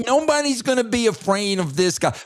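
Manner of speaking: nasally voice. Shown as a demonstration. Co-host ridiculing a wrestler to Coach, high and nasally. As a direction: talk through the nose in ridicule. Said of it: "a guy that talks like this".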